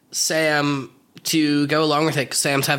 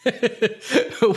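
laughing; 7 semitones higher than usual. Real, not acted. Laughing at Court joke.

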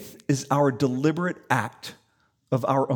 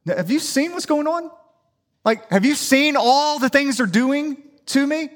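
higher, pleading outrage to God; indignant plea; 8 semitones higher than usual. Retold or imitated. David complaining to God about his enemies. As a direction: press God for justice. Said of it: "David says to God".

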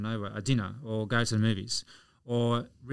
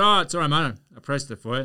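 stranger replying; 5 semitones higher, 8 dB louder than usual. Retold or imitated. Stranger in the lift answers.

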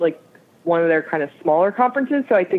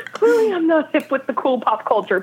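laughing; 9 semitones higher than usual. Real, not acted. Laughing at own cluelessness.

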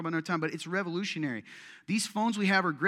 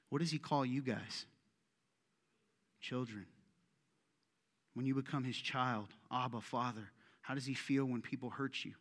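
soft, low, heartfelt preaching; tender, reverent; 5 semitones lower, 10 dB quieter than usual. Real, not acted. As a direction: let it sink in gently, with long pauses. Preacher turns tender about God's love.

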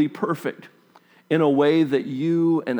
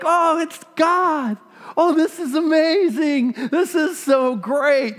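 much higher, laughing, giddy with delight; giddy excitement; 14 semitones higher than usual. Retold or imitated. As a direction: gush like a thrilled fan, laughing as you go. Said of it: "you would think he would be like".

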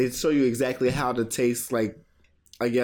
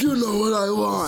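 high mocking wrestler voice; mock wrestler promo; 7 semitones higher than usual. Co-host joins mocking the wrestler, high whiny voice.

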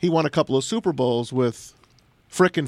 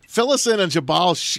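normal talking voice

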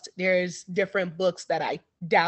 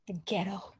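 whispered aside, much softer; 13 dB quieter than usual. Real, not acted. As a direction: breathe it as a secret.

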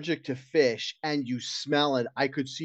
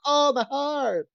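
slow, high hammed-up Italian accent; overacted Italian accent clutching his heart; 13 semitones higher, 4 dB louder, slower than usual. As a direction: fake a heart attack in a mock Italian accent. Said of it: "give this guy Italian accent".

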